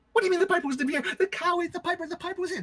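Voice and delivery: high, insistent protest; indignant wife. Acted. Wife arguing back at her husband, high and insistent. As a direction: insist shrilly he was here.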